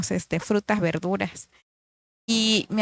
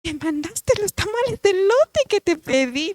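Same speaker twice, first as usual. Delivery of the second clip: much higher, amazed exclamation; delighted realization; 9 semitones higher than usual. Retold or imitated. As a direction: marvel that the prayer was answered. Delighted realization about tamales. (asombro encantado en tono mucho más alto)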